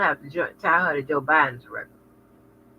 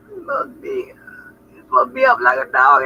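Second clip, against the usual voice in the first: laughing realization; 8 semitones higher, 6 dB louder, faster than usual. Real, not acted. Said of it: "realizing her mistake".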